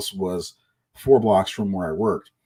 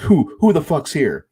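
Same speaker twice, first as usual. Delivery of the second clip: sharp, higher, louder demand; jealous demand; 7 semitones higher, 5 dB louder than usual. Retold or imitated. Jealous girlfriend demanding who's there, sharp and loud. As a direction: demand to know who's in the house.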